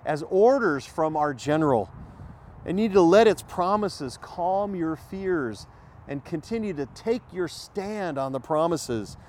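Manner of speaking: ordinary delivery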